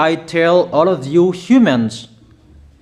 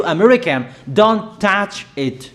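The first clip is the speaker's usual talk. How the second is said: normal talking voice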